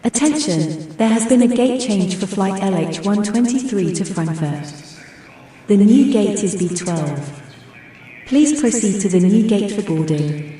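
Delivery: airport announcement. Shown as a demonstration.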